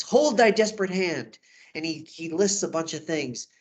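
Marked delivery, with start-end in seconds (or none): 0.0-1.4 higher, louder acted command; theatrical urgent order; commanding Friar Lawrence. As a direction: halt a desperate man with urgency. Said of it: "his reaction to Romeo is".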